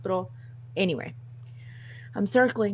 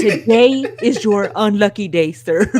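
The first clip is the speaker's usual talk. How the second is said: loud, drawn-out mock pronouncement through laughter; amused, emphatic delivery stretched out for comedy; laughing announcement; 9 dB louder, slower than usual. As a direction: break the bad news slowly and loudly, tickled. Mock bad news, laughing.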